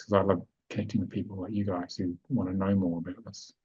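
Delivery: ordinary talk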